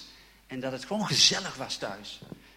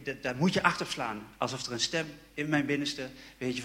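normal talking voice

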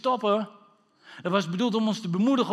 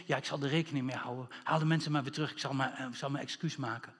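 soft, low, hurried mock apology; mock accommodating; 5 semitones lower, 8 dB quieter, faster than usual. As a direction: play down an apologetic climb-down, quietly. Mock mild apologetic Jesus reply. (quasi-verontschuldigend, zacht)

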